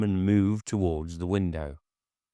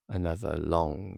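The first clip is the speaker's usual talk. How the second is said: plain reading voice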